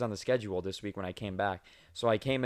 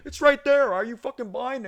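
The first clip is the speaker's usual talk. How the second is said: much higher, louder furious snap at the cashier; angry yell; 14 semitones higher, 6 dB louder than usual. Retold or imitated. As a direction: yell it like an enraged customer. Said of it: "He's like".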